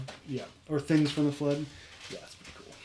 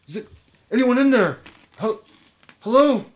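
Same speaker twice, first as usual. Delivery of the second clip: high, loud call through a door; muffled call; 10 semitones higher, 9 dB louder than usual. Acted. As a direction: call out to be heard. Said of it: "a muffled voice".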